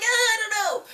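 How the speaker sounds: high, laughing whine; laughing hesitant cry; laughing mock hesitation. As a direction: dodge the thought with a laugh. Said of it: "then I was like".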